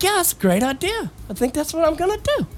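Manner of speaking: high, sarcastic dumb-idea voice; mock eager inner voice. Retelling his angry self mocking his own bad plan, high and sarcastic. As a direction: play the idiot who thinks it's genius.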